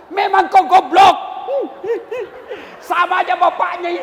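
shouted insult at a husband, hammed up; angry insult. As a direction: yell it like a scornful wife. Wife insulting husband, acted. (hinaan marah berteriak)

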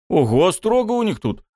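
high surprised exclaim; impressed. A narrator's reading. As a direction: sound impressed. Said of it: "впечатлился я". (впечатлённо)

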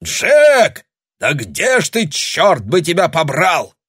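high, loud calling yell; angry shout. A narrator's reading. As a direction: yell for him, annoyed. Pirate yells for Jack.

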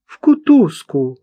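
high, loud exclamation; crying out. A narrator's reading. Old woman reacting to where he will be taken, crying out. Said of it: "вскрикнула Марта".

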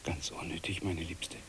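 soft, low reassuring whisper; calm her gently under your breath; soothing whisper. Acted. Mann beruhigt flüsternd. (beruhigendes, leises Flüstern)

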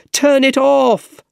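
high, drawn-out urgent shout. A narrator's reading. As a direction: shout the order over the danger, stretching it out. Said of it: "shouted Harry".

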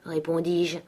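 ordinary delivery

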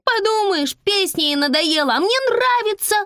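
put-on very high bratty child voice; high-pitched childish defiance; defiant little girl. A narrator's reading. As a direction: sass back like an outraged kid. Girl talks back to mother.

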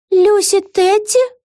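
high, slow, rising question; astonished question. A narrator's reading. Vega comes to her senses, astonished. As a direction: say the name slowly in high-pitched disbelief. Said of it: "удивлённо пробормотала Вега".

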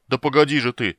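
loud protesting yell. A narrator's reading. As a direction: shout to stop him. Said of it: "завопил Флинт".

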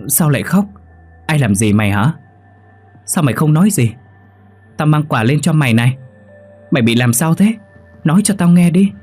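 anxious pleading. Acted. Friend questions the weeping girl outside the door, anxious pleading. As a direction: beg a silent friend to answer.

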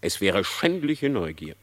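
plain reading voice

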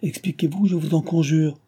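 high, pleading cry; urgent exclamation. A narrator's reading. Woman begs him to explain, urgent exclamation. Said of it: "s'écria-t-elle".

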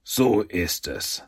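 slow, rasping villain voice. A narrator's reading. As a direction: rasp it out slowly, savouring the moment. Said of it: "mit seiner schnarrenden Stimme". (langsame, schnarrende Schurkenstimme)

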